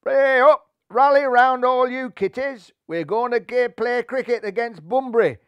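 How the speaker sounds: loud, high Yorkshire rallying cry; cat calling friends to cricket. Acted. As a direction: rouse the kitties. Cat rallies his friends.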